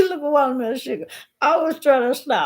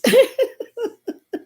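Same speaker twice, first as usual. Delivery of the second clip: high burst of laughter; 11 semitones higher, 4 dB louder than usual. Real, not acted. Laughing at her own joke.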